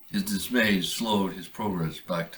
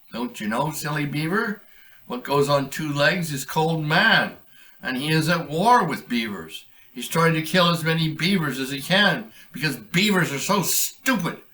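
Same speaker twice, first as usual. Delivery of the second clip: higher, louder mocking coyote; sly coyote; 8 semitones higher, 5 dB louder than usual. Coyote taunting the beaver about man. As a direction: sneer down at the silly beaver.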